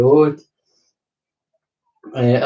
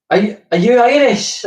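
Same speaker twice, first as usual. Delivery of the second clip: much higher, louder voice of a random guy; stranger's question; 11 semitones higher, 5 dB louder than usual. Retold or imitated. Man outside the call box asks if he's Irish, high loud voice. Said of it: "this guy went".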